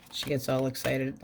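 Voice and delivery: ordinary talk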